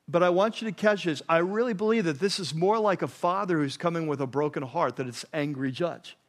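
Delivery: usual speaking voice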